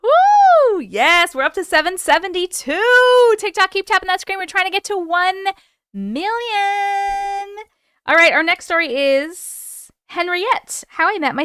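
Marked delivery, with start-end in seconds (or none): 0.0-0.8 high, loud celebratory whoop; excited woo. Real, not acted. Cheering the tap count. 0.8-7.6 high-pitched, rallying cheer; hyped up. Real, not acted. Cheering viewers toward a goal.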